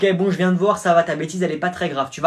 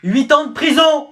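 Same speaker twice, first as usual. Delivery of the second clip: outraged verdict; 11 semitones higher, 5 dB louder than usual. Acted. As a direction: thunder out the verdict. Judge's outraged sentence.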